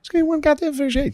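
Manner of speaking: very high, thin pleading squeak; mock pleading. Friend mocks his own plea, very high squeaky whine.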